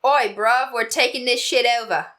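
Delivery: put-on a weak stab at a British accent; clumsy mock British thug voice; bad British accent. Mock British accent as Bristol addicts barge into his trip. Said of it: "I can't do accents".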